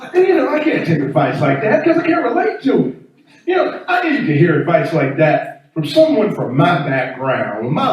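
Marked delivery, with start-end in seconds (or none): none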